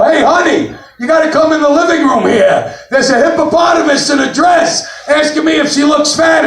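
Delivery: high-pitched calling out, mock yell; yelling to kitchen. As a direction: call across the house to your spouse. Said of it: "I yell in the kitchen".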